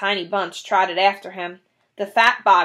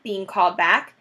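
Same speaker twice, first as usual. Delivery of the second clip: ordinary delivery, nothing marked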